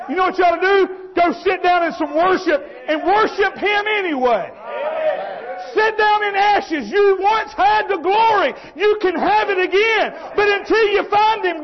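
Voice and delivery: high, forceful revival preaching; impassioned preaching. Real, not acted. Preacher urges people back to worship, impassioned preaching. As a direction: cry it out to rouse the congregation.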